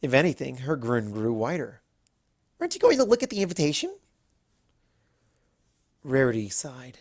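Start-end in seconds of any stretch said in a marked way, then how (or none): 2.6-4.0 high, fast, bubbly pony chatter; Pinkie Pie voice. Acted. Pinkie invites Rarity to a party, high and breathless. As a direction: gush it out like an excited party pony.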